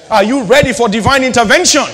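building to a shout; preacher's shout. Real, not acted. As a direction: shout it harder the second time. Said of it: "I say".

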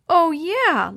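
high, loud excited exclamation; sudden realization. A narrator's reading. Chris finally understands the code idea, excited. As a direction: let it dawn on you, delighted.

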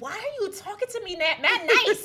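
very high, loud mock exasperation; incredulous protest. Questioning the gentle voice, loudly incredulous. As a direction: squeal it back in disbelief.

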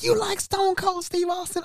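very high, drawn-out fanboy disbelief; excited. Retold or imitated. Dad hears guest likes his favorite wrestler, thrilled. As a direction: squeal the question slowly in amazement. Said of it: "he got excited".

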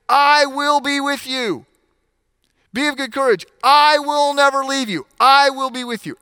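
high, loud preaching, voicing God's promise; full-force preaching. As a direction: proclaim it to the whole congregation.

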